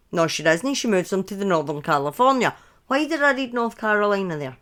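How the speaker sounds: ordinary talk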